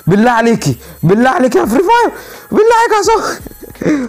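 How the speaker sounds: high-pitched pleading that climbs to a squeal; hammed-up frantic plea; mock desperate begging. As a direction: beg the game, pitch rising each time. Begging the game for luck.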